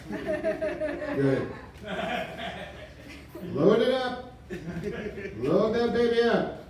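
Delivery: high, gleeful command; manic glee. Acted. Comic asks Ed to load his gun, high manic glee. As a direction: hype it up madly, pitched high. Mock suicide bit closer.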